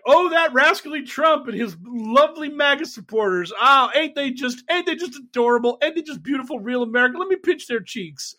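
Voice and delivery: put-on high, cooing grandma voice, fawning over cute kids; doting media voice. Pundits gushing over Trump supporters, high doting coo. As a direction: coo like a gushing granny pinching cheeks. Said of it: "our media is just like".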